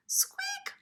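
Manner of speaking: very high squeak; startled mouse squeak. Acted. Mister Mouse startled by the break, high squeak. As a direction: squeak in fright.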